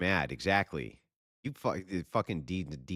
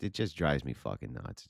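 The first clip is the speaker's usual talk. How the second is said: ordinary delivery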